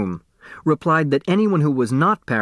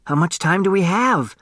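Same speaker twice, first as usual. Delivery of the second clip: put-on young girl voice; 7 semitones higher than usual. A narrator's reading. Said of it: "Turtle asked".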